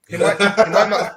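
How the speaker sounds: laughing through the words; giggling while making the point. Real, not acted. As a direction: breaks into a real laugh mid-line.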